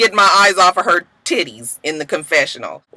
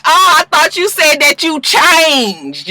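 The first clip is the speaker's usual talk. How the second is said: high, drawn-out mock surprise; mocking disbelief; 7 semitones higher, slower than usual. [name] doubting a friend's claimed change, mocking disbelief. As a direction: stretch it out slow and high, teasing.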